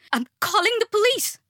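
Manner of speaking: high-pitched frantic threat; panicked mother's high yell. A narrator's reading. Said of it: "she yelled".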